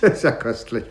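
laughing through the words, amused scorn; mocking laugh. Lagrange laughing at the prisoner, mocking amusement. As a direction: chuckle it out, he finds this absurd.

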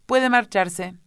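loud, high-pitched dismissal; curt royal dismissal. A narrator's reading. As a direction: send the witness off with royal authority. King dismissing the witness.

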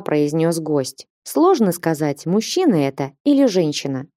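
ordinary delivery

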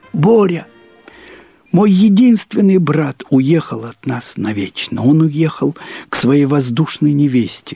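ordinary delivery

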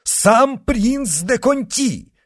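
high, loud, drawn-out announcement; excited exclamation. A narrator's reading. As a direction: exclaim it slowly, amazed at the guest. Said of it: "воскликнул Блан-Миниль". (восторженный протяжный возглас)

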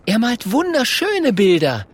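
high-pitched little character voice; eager Mathilda. Acted. Mathilda praises Klonk's pictures to the bear, eager.

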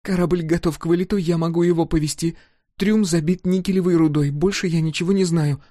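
a scared captive blurting everything out; gabbling girl. A narrator's reading. The captive girl rattles off answers to Scar. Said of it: "затараторила она".

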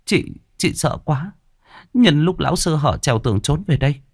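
breathless telling of a narrow escape; a hint of a scared older sister pleading; frightened sister. A narrator's reading. Sister retells fleeing danger.

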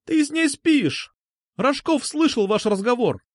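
put-on high-pitched girl's accusing cry; hysterical-edged schoolgirl outburst; outraged accusation. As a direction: hurl the charge in a high voice. Girl hurls accusation at him.

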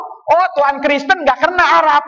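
ordinary talk

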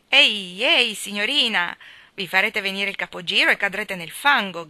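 loud call across the yard; shouted warning. A narrator's reading. Luke the miller warns a dizzy girl, shouted warning. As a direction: call out loudly to warn her. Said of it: "gridò Luke".

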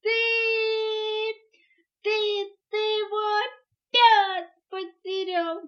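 high, loud, halting accusation; crying out. A narrator's reading. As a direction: wail it out slowly, stuck on the first word. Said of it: "закричала мартышка". (с криком, обвиняя)

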